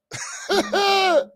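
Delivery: very high cracked-up laugh. Real, not acted. Streamer cracking up at his Japanese bit, high laugh.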